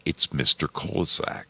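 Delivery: usual speaking voice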